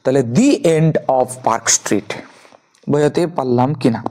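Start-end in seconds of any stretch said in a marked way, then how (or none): 0.3-2.1 the said "dee" before end, slowly; slow phrase, long "ee" in the. Shown as a demonstration. Teacher models the vowel rule slowly, the as "dee". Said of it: "উচ্চারণ হবে দি".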